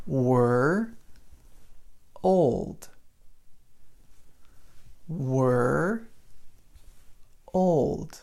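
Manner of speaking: put-on "were" and "old" split with a long pause; separated syllables. Shown as a demonstration. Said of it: "the same were as in we were".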